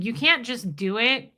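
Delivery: ordinary talk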